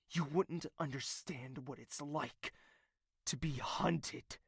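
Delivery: hushed whisper; haunted whisper. Acted. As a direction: drop to a whisper on "hunted". Villain reveals his past.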